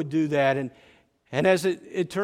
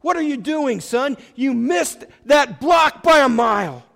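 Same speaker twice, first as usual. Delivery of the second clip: loud, high furious coach's yell, slowing to a hard finish; hammed-up shouting coach chewing out his running back; angry yell; 7 semitones higher, 7 dB louder than usual. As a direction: bark at the player like a furious coach. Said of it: "he yells at Petey".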